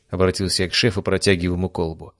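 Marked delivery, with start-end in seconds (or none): none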